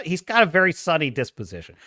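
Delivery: usual speaking voice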